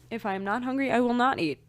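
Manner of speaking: normal talking voice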